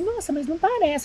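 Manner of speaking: lightly voiced mom saying "não parece"; mother reassuring. Mom lightly voiced telling her daughter the baby doesn't look it. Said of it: "a minha mãe".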